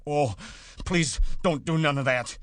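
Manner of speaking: high, loud, tearful-sounding plea; frightened begging. A narrator's reading. Big man begging the struggling woman, frightened. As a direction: beg as if about to cry with fright. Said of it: "began to cry with fright".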